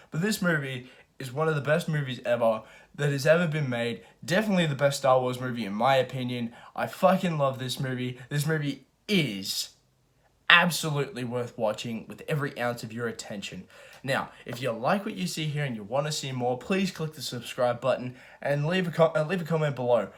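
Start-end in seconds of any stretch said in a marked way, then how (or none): none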